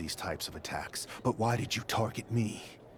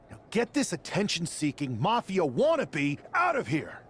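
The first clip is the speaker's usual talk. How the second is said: high, louder order to throw him out; angry dismissal; 6 semitones higher, 3 dB louder than usual. Acted. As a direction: run out of patience. Wrestler ends the confrontation.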